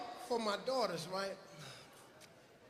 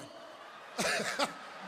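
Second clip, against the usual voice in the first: 1 wheezing laugh; 2 semitones lower than usual. Real, not acted. Laughing at contestant.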